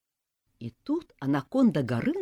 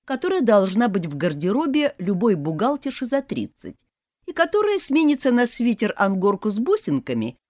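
plain reading voice

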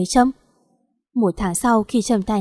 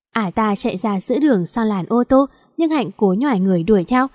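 plain reading voice

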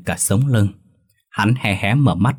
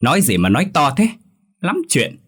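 scolding snap; 7 semitones higher than usual. A narrator's reading. Neighbour woman telling off two young tenants, high-pitched scolding. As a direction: snap crossly in a higher voice. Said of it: "Bà ta quắc mắt về hai người rồi quát".

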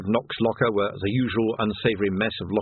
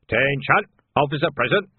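higher, louder call to attention; shouted command; 5 semitones higher, 4 dB louder than usual. A narrator's reading. Nock alerts the watchmen to an officer, shouting. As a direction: call the room to order. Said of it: "He glanced past Vimes and shouted".

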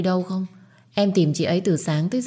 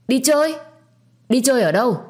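loud, much higher shocked outcry; outraged surprise; 8 semitones higher, 4 dB louder than usual. Acted. Grandmother hearing Phượng went out, outraged shout. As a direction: shriek it in angry disbelief.

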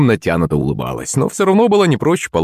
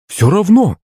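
high-pitched indignant dismissal; exasperated mother's outburst; insistent; 10 semitones higher, 3 dB louder than usual. A narrator's reading. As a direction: cut off the excuses firmly.

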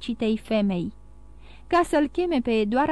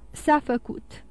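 ordinary delivery

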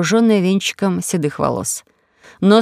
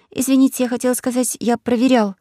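put-on higher, quick girl's correction; flustered girl; 5 semitones higher than usual. Disguised girl Alice corrects her slip to Semiis, slightly higher.

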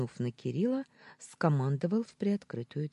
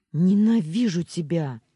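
loud, raised cry of hatred; shout; 4 semitones higher, 10 dB louder than usual. A narrator's reading. Julia shouts her hatred at her captor, loud. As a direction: throw the hate at him. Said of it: "крикнула".

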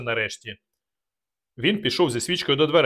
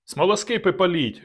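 shouted order; 6 semitones higher than usual. Acted. Narrator ordering torches lit, shouting. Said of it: "крикнув я".

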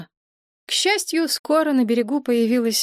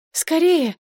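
high, panicky call for help; urgent blurt; 8 semitones higher, 3 dB louder than usual. A narrator's reading. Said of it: "выпалила Мария".

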